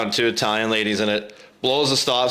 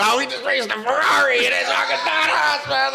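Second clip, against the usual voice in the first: high, shouted boasting; excited bragging; 13 semitones higher than usual. Retold or imitated. Teen telling buddies about the race, excited bragging. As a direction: crow about it at the top of your range.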